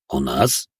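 questioning drone. A narrator's reading. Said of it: "вопросительно прогудел робот".

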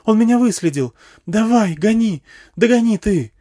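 very high, loud, frantic urging; woman panicking at her pursuer, pitched right up; panicked woman. A narrator's reading. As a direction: scream at the driver to get going. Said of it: "заметалась женщина".

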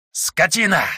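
high, venomous outburst; furious snake man. Acted. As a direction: spit the insult in rage. Snake lord insults the hero. (яростный змеиный выкрик)

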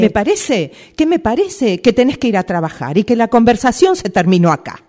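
loud, rising angry retort; angry outburst. Acted. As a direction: explode and shut the talk down. Boss ends the argument.

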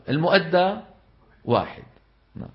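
normal talking voice

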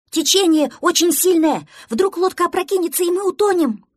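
high, loud cry of danger; alarmed shouting. Acted. Duckling warns crewmate in pretend boat, alarmed shouting. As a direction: shout a warning over a rushing current.

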